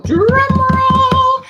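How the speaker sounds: high, loud, long-drawn call; game-show winner build-up; drum-roll announcement. Pretend. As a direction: stretch it out like a rolling drum. Building suspense before announcing winners.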